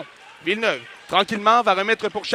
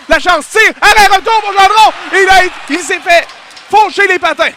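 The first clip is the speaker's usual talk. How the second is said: rising, louder play-by-play; excited sports commentary; 9 semitones higher, 9 dB louder than usual. Real, not acted. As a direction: push the energy up on the rush. Scoring chance in play-by-play. (commentaire de match qui s'emballe)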